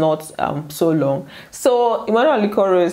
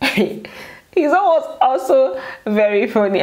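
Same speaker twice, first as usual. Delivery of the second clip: laughing; 6 semitones higher, slower than usual. Real, not acted. Laughing while recounting.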